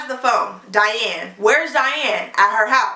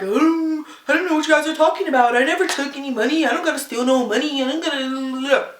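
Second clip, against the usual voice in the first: imitating Tom; 3 semitones higher than usual. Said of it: "Tom pulls up like".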